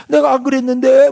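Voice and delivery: high-pitched acted denial; mock protest. Slandered person answering the accusers, mock protest.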